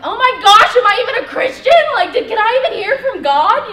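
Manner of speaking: loud, high-pitched mock panic; freaking out. Woman doubting herself as her chance walks away, freaking out. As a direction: wail it in comic self-doubt. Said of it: "I'm, like, freaking out".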